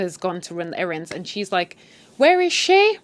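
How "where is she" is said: high, loud call with a rise. Retold or imitated. Nanny walking in calling for the mother, high and loud. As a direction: call through the house looking for her.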